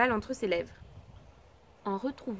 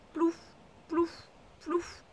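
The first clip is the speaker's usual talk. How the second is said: plouf sound effect; 5 semitones higher, much slower than usual. Acted. Sound effect of frog climbing stairs.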